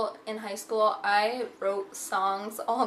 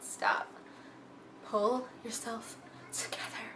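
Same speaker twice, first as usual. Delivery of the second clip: emotional; 10 dB quieter than usual. Real, not acted. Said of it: "See what I mean by emotional?".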